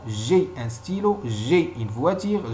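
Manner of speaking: ordinary delivery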